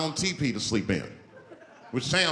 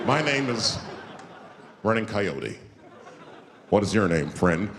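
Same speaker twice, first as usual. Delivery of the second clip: low, measured, ceremonial introduction; a lightly voiced solemn Native elder; solemn Native man; 6 semitones lower than usual. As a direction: announce your name with gravity. Running Coyote introduces himself.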